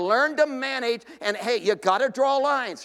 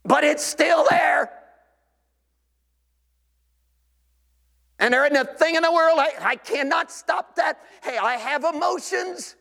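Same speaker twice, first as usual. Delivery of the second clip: defiant self-defense; 3 semitones higher than usual. Acted. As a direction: bristle like a man defending his temper. Acted defensive churchgoer justifying temper.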